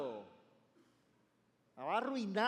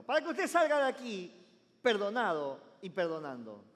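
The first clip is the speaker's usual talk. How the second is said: louder, hammering repeat of the same line; emphatic repeat; 8 dB louder than usual. Shown as a demonstration. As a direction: drive "salga de aquí perdonado y perdonando" home. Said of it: "Se lo voy a decir de nuevo". (repetición enfática y fuerte, marcando "salga" y "perdonando")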